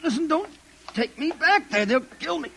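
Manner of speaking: high, frightened begging; frantic pleading. Acted. Drugged man begs the officer not to take him back, frantic pleading. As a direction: beg for your life.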